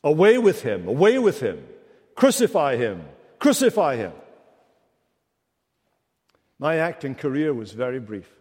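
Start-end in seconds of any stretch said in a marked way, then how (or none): 0.0-4.1 loud chanted cry of the mob; crowd crying out. Acted. Preacher voicing the crowd demanding crucifixion, loud. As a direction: bark it like an angry mob. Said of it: "they cried out".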